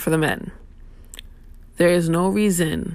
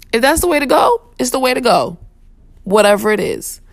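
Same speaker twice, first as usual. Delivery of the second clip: defiant bravado; 6 semitones higher, 4 dB louder than usual. Real, not acted. Defiant joke about dying.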